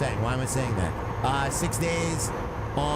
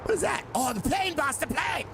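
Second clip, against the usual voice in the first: mock shout; 9 semitones higher than usual.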